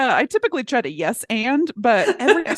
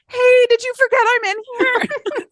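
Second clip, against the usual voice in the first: much higher, squeaky little voice; high squeaky devil; 4 dB louder than usual. Retold or imitated.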